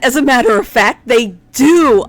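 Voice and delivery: delighted announcement. Real, not acted. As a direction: answer with big, booming eagerness. Eager answer to viewer question.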